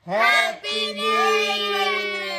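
slow, high laughing cheer; excited shout. Real, not acted. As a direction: yell it out at midnight.

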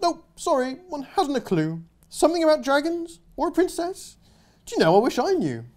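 cheerfully clueless knight; cheerful posh knight. Acted. Knight shrugging off his quest, breezy and high.

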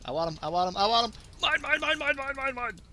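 building to high, rapid-fire glee; excited chant rising in pitch; greedy excitement. Real, not acted. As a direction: start near normal, climb high as you grab for it. Greedily chasing a kill.

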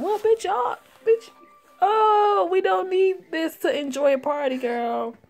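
squeaky high sassy friend; high girly character voice. Acted. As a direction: play it up as a high-voiced diva. Voicing a high-pitched character.

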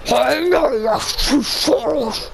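slow villain line; lightly voiced supervillain; Doctor Doom voice. Shown as a demonstration. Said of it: "let me try".

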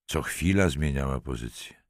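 ordinary delivery

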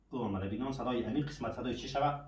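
ordinary delivery

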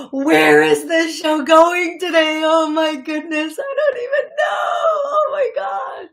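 high-pitched laughing exclaims. Real, not acted. Medium laughs at where the show is going, very high. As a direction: cry out through laughter, way up high.

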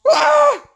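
loud, high-pitched yell; startled scream. Real, not acted. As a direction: cry out in sudden alarm. Startled scream during game.

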